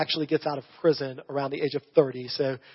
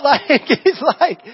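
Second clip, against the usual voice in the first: laughing; 10 semitones higher, 9 dB louder, much slower than usual. Real, not acted.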